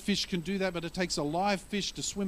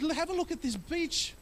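high, condescending grown-up voice; scoffing man; 5 semitones higher than usual. Retold or imitated. Man on the beach scoffing at the boy, high and condescending. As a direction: talk down to the boy. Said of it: "He said".

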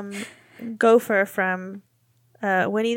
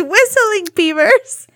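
much higher and louder, breaking into laughter; laughing correction; 8 semitones higher, 9 dB louder, slower than usual. Real, not acted. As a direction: burst out laughing through the words. Correcting the word, cracking up.

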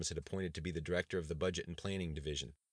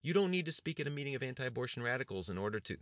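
higher, pleading aide, cut off; protesting aide; 8 semitones higher than usual. Acted. Aide protests to the governor, higher and pleading. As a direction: push back before being interrupted.

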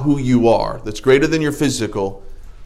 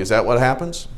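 ordinary talk

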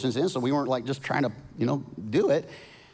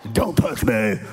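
louder, laughing demonic growl; 4 semitones lower, 5 dB louder, slower than usual. Retold or imitated. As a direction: ham up a possessed child. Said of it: "I cast a demon out real quick".

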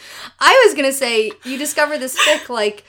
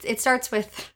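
ordinary delivery

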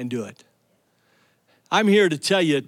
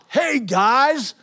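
raised, teasing call across the site; mock yell to the crew; 6 semitones higher, 3 dB louder, much slower than usual. Friend calling out to the workers, raised mock yell. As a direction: shout it out to the other workers, slow and gleeful. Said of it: "yells out".

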